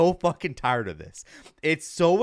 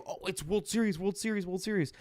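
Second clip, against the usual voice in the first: higher, dreamy mock chant of it; mock Yankee fans; 6 semitones higher, 5 dB quieter than usual. Mocking Yankee fans hyping the title, higher mock-excited voice. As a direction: parody fans hyping themselves up. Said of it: "they've been saying".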